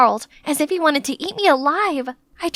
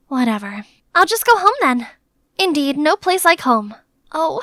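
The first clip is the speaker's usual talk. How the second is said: usual speaking voice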